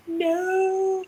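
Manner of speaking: high, long drawn-out "no"; hammed-up wail of dismay; mock wail. Mother begging her midwife not to leave, mock wailing.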